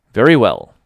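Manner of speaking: short, loud and crisp. A narrator's reading. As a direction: clip it off, giving nothing away. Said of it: "Marilla's crispness".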